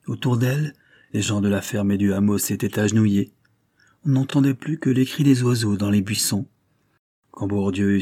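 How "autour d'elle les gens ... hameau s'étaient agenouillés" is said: usual speaking voice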